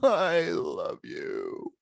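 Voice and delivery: slow, high, doting I love you; melt, drawing out every word; swooning reply. Retold or imitated. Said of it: "I was like".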